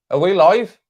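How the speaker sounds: high, loud rising question; incredulous check. Real, not acted. Streamer doubts anyone is watching, incredulous loud question. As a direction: call out as if nobody can hear you. Repeats check as nobody answers.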